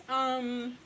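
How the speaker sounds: a teary laughing um; half laugh half sob; laughing crying. Real, not acted. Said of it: "I'm getting a little emotional".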